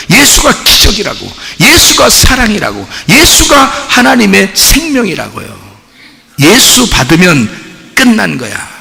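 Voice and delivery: high, driving preacher's cadence; emphatic preaching. Real, not acted. Preacher urging the congregation that Jesus is enough, emphatic. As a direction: hammer each declaration, stretching the closing questions.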